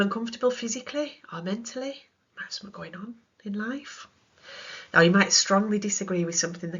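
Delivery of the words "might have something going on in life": whispered. Real, not acted. Whispered aside.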